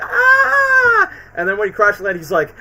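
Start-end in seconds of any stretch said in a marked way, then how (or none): 0.0-1.1 very high, loud cartoon scream. Spider-Man web-swinging as helicarriers crash, screaming high. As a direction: shriek like falling from the sky. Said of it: "Spidey just goes screaming like".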